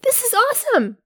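high, eager pitch to strangers; bubbling over with enthusiasm; excited. Pretend. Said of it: "jump in people's faces".

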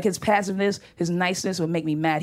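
usual speaking voice

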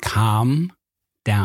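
"Calm" and "down" split apart, mouthed slowly; slow, exaggerated "Calm down". Shown as a demonstration. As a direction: stretch "Calm" out, pause, then "down". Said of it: "I'm out".

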